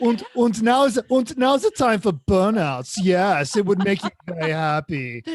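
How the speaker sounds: a bad German accent for the car. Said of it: "I'm doing a bad German accent".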